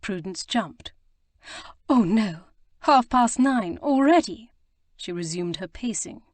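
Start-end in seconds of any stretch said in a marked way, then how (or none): 1.9-4.5 a touch of alarm, louder and slower; lightly voiced young lady, caught off guard by the hour; startled. A narrator's reading. Prudence startled by the clock.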